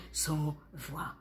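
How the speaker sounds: near-whisper with long gaps; hushed narration. A narrator's reading. Narrator on the technicians left without a voice, hushed. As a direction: let the voice die away, stunned.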